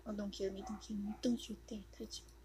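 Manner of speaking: soft, fast pleading; frightened whisper. Real, not acted. Player pleading with a killer, hushed and fast. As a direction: beg under your breath.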